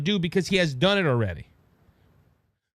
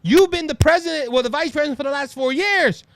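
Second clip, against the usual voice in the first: questioning voter; 6 semitones higher, 4 dB louder than usual. Retold or imitated. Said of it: "when people ask".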